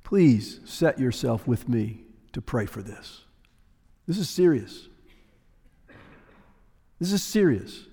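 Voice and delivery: quietly impress on them how serious it is; solemn plea. Real, not acted. Preacher asking the congregation to join in prayer, hushed and solemn.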